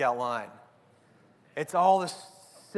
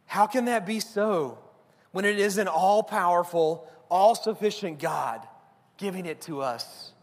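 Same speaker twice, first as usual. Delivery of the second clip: ordinary talk, nothing marked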